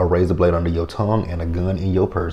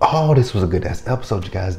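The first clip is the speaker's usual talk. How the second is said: normal talking voice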